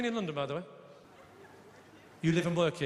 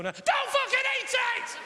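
high, slow, drawn-out yell; mock outrage; 4 dB louder, much slower than usual. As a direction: stretch the punchline into a slow shout. Punchline yelled slowly.

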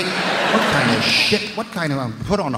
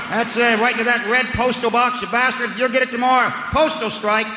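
higher, louder mock fury at the post; hammed-up outraged Brit spitting over a letter; snapped Brit rant; 6 semitones higher, 4 dB louder than usual. Comic tells crowd how a snapping Brit rants, mock furious. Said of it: "they've truly snapped".

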